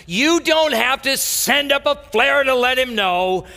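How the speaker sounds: high, ringing preacher voice; preaching at full force. Acted. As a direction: proclaim the good news. Preacher quoting and building.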